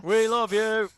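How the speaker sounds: loud, high, heartfelt call; loud shout. Real, not acted. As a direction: shout your love out loud. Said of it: "We could put out our emotions on YouTube".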